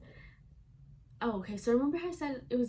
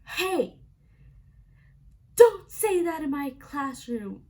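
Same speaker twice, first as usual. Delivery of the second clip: high, much louder scolding yell; teacher screaming; 6 semitones higher, 10 dB louder, slower than usual. Retold or imitated. Teacher yelling at a student for swearing in class, loud and high. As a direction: bellow it like a furious teacher. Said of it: "he screams and he's like".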